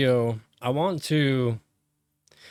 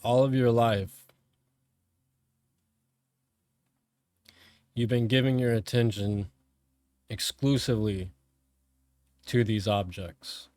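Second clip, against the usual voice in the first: calm, measured meditation voice with long pauses; guided meditation; 3 semitones lower, 3 dB quieter than usual. Real, not acted. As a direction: guide them inward, phrase by phrase, letting each one settle.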